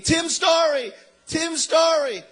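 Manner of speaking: high, loud cry of a name; imitated scream. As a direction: scream it like an astonished woman. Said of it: "she's screaming".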